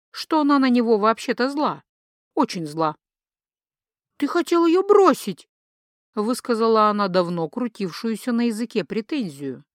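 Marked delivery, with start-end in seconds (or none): 4.2-5.5 high, loud reproach; indignant accusation. Acted. Wife finally airing her grievance to husband, indignant. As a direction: burst out with the accusation.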